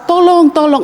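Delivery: high call from down the well; cry for help. Retold or imitated. Man in the well heard from above, high cry. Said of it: "dia mendengar suara".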